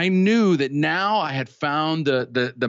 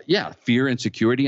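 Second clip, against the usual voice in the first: ordinary talk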